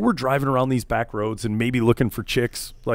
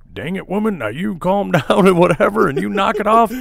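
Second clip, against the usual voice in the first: a hint of a higher, louder stepdad scolding through a laugh; 7 semitones higher, 4 dB louder than usual. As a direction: imitate the stepdad telling the mom off. Stepdad scolding mom, retold.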